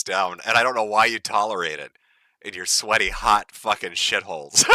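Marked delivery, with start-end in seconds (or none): none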